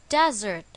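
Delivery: "desert" with the stress on its first syllable, DEZ-ert. Shown as a demonstration. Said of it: "the stress is on the first syllable".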